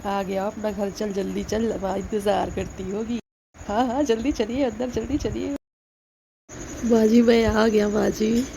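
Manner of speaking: normal talking voice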